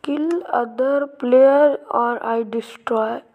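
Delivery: loud, drawn-out reading; reading out a threat. Real, not acted. Player reads out a threatening note found in game, slow and loud. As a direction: read the threat slowly and loudly.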